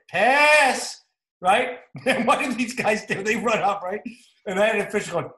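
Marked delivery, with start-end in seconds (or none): 0.1-1.0 loud, high, drawn-out sideline yell. Shown as a demonstration. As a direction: yell it out as a call to the team. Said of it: "yell out when we're doing it".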